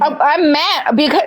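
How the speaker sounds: ordinary delivery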